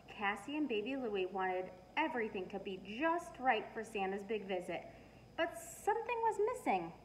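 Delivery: plain reading voice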